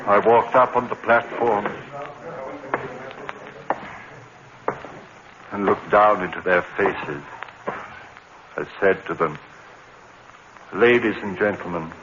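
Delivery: low, somber retelling; dazed confession narration; hushed narration. A narrator's reading. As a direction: recount it numbly.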